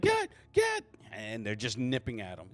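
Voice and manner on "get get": high, loud warding-off shout; acted yell. Game master plays a man with a spear fending off wolves, high loud yell. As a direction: shout it high to drive off the pack. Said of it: "He's like".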